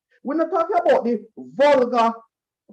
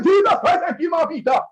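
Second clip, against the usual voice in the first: relieved shout; 6 semitones higher than usual. Retold or imitated. Finding Peter in the bush, shouting with relief. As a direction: shout it out on finding him alive. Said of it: "we say".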